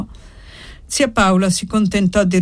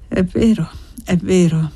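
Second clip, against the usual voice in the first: ordinary delivery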